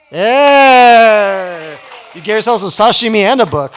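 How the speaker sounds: cheering loud and high, then rushing on; real delight at the winning ticket, fast; excited cheer. Real, not acted. Emcee cheering the raffle winner to the crowd, excited loud cheer.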